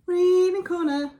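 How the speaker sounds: high, loud jingle call; announcer voice. Acted. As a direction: announce it like a show segment. Announcing segment.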